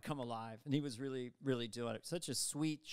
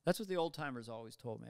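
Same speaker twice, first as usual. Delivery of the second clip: ordinary talk, nothing marked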